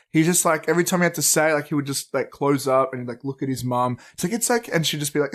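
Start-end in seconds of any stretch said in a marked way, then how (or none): 4.3-4.7 high, gentle imitation of a mum; lightly voiced, soothing encouragement; reassuring mum. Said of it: "encourage him 'cause he was so nervous".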